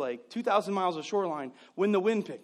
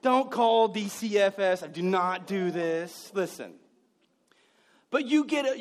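normal talking voice

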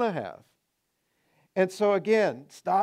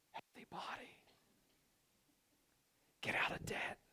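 soft unvoiced whisper; 20 dB quieter than usual. Preacher voicing the quiet inner voice, a soft whisper. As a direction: barely breathe the words. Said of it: "is a whisper".

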